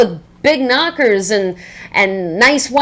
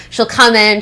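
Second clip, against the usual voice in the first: normal talking voice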